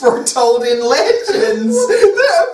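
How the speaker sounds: mock prophecy rising into a squeal; dissolving into laughter. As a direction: start solemn, then crack up helplessly. Reader corpses during acted prophecy.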